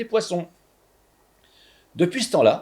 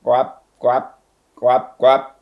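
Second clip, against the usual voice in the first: frog croaks like hiccups; hiccupping frog croak, getting louder; 3 semitones lower, 6 dB louder, much slower than usual. A narrator's reading. Frog dragging itself on the floor, hiccupping croaks. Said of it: "en criant comme si elle avait le hoquet".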